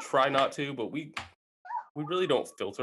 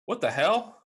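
lightly voiced little boy, higher; 8 semitones higher, slower than usual. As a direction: imitate a puzzled kid's higher voice. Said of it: "my youngest son was on the iPad".

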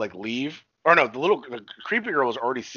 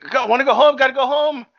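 high squeaky mock kid, much higher and louder; 7 dB louder than usual. Retelling the movie kid trying to drive the bus, squeaky kid voice. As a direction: squeak it out like a desperate little kid.

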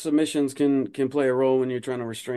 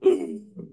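sharp winded gasp for air; imitated gasp; 3 semitones lower than usual. Shown as a demonstration. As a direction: act out getting the wind knocked out. Demoing a winded opponent.

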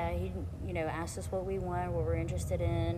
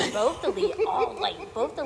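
giggling; 4 semitones higher, 7 dB louder than usual. Real, not acted. Gushing over singers.

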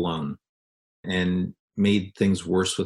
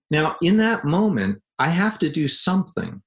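usual speaking voice

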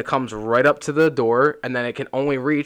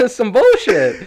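amused complaint; 10 semitones higher, 7 dB louder, slower than usual. Real, not acted. Laughing complaint about theft.

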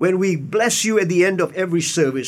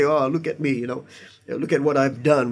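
mock boasting; 5 semitones lower than usual. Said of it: "unless you're a little bit weird".

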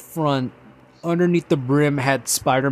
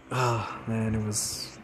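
ordinary talk, nothing marked

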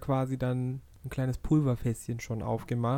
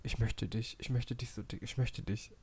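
whispering; 2 semitones lower, 8 dB quieter than usual. Retold or imitated. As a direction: whisper it like a clingy plea. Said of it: "Und er ist so dolle".

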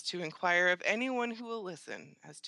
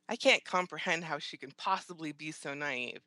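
usual speaking voice

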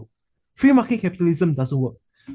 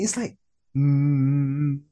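silent hum; much slower than usual. Retold or imitated. Imitating silent Tesla.

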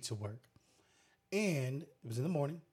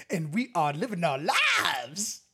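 drawn-out, shouted announcer hype; hyped show intro; 3 semitones higher, 6 dB louder, slower than usual. Pretend.

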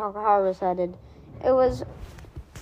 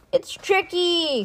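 cry out; 8 semitones higher, slower than usual. Acted. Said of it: "cried Nicky".

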